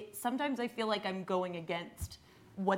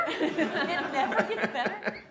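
laughing while saying it; slow and laughing, a little despairing; 8 semitones higher, 5 dB louder, slower than usual. Real, not acted. Laughing at the hopelessness.

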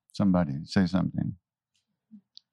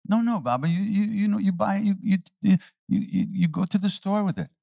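higher, stumbling over words; flustered stammer; 10 semitones higher than usual. Retold or imitated. Man stammering as he explains money to Maharaji. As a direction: stammer while trying to explain. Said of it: "He said".

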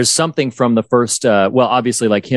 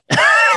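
burst of high laughter. Real, not acted. Laughing at the scene.

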